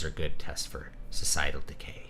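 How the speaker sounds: low, hushed whisper; whispered aside. Real, not acted. Aside to the listener about nurses, whispered. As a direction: lean in and whisper it.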